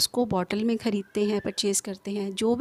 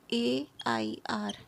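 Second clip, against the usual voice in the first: slow, softer letter-by-letter "ए आई आर"; spelling out; 7 dB quieter, much slower than usual. Shown as a demonstration. Teacher spelling the word for learners, slow separate letters. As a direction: spell "ए आई आर" with a pause after each letter. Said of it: "ऐसे pronounce करेंगे इसको".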